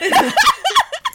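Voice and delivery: loud high burst of laughter. Real, not acted. Cohost laughing at her own brag, loud laughter. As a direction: cracks up loudly.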